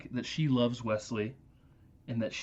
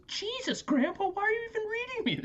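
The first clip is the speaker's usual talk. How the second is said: put-on very high, exasperated kid; bratty kid voice. The grandson snaps at Grandpa, very high bratty kid. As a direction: squeal it like an annoyed boy. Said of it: "and then he goes".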